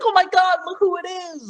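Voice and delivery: high, squealing star-struck fan voice; mock LA fan gasping over a star; gushing fan. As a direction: squeal like a fan spotting a celebrity. LA fans spotting a celebrity.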